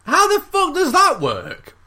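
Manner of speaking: very high, drawn-out disbelief; incredulous. Real, not acted. Co-host questioning the storyteller's alien tale, incredulous. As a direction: wail it out, utterly baffled.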